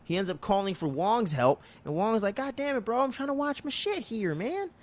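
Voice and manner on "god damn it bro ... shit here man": high, softer mock exasperation; retold irritated whining; annoyed whine. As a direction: complain in a pitched-up voice at being interrupted. Acting Wong annoyed at interruption.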